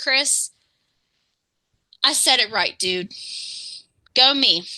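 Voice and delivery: ordinary delivery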